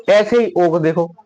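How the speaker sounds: ordinary talk, nothing marked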